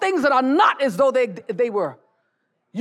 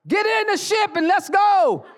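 slow, laughing shout lifted high; rallying shout; 9 semitones higher, slower than usual. Real, not acted. Preacher calling the church to go, joyful laughing shout. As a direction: call them aboard with a joyful shout.

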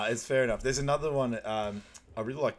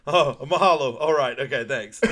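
loud, higher-pitched put-on reply; hammed-up fake pleasantries, much louder; faked phone chat; 4 semitones higher, 11 dB louder than usual. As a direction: pretend to wrap up a phone call. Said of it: "he just fakes like".

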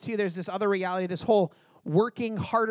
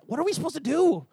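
crying out; 7 semitones higher than usual. Retold or imitated. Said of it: "we were crying out".

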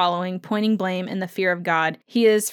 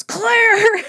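a much higher, louder cry of her name; frantic shout; 13 semitones higher, 7 dB louder than usual. Retold or imitated. Jamie shaking Claire awake, shouting her name in panic.

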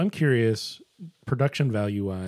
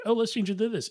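casual idea; 10 semitones higher, faster than usual. Retold or imitated. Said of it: "they're like".

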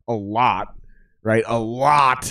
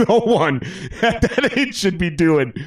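laughing; 6 semitones higher than usual. Real, not acted. Laughing over his young excess.

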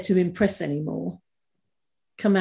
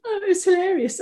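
high voice, breaking with laughter; helpless laughter; 10 semitones higher than usual. Real, not acted. Recalling the hilarious phone call, real laughter. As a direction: recover from a real laughing fit. Laughing at her own story.

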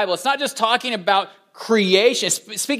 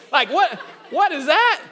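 baffled; 7 semitones higher than usual. Acted. Acts shock at own new accent.

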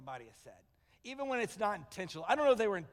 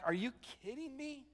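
acted hurt disbelief, pitch raised; wounded and offended; 3 semitones higher than usual. Joseph to the brothers who sold him, wounded and indignant. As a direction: protest as the betrayed benefactor, building in force. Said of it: "He was so wounded and offended".

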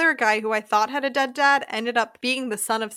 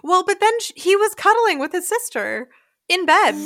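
higher, louder, insistent objection; animated protest; 7 semitones higher, 5 dB louder than usual. Real, not acted. Host objecting about the siblings in the film, scandalized. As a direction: pitch up in scandalized disbelief.

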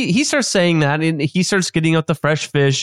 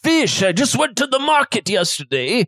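chef character voice; 6 semitones higher than usual. Acted.

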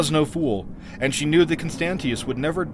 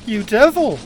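high, loud outraged exclamation; angry cry; 9 semitones higher, 8 dB louder than usual. A narrator's reading. Queen to her treacherous sister, crying out angrily. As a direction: hurl the insult as a betrayed queen. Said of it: "cried Taramis".

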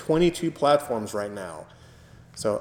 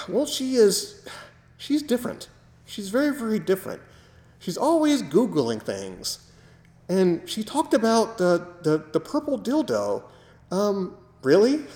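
higher lightly voiced mother, puzzled; imitating his mother; 8 semitones higher than usual. Son imitating his mother to his co-host, higher and puzzled. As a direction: raise the pitch for a bemused mom. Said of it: "She goes".

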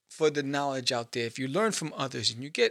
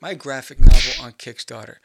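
loud pitch; 4 semitones lower, 16 dB louder than usual. Real, not acted. Loud burst.

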